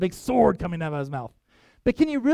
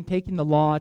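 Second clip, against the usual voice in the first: ordinary talk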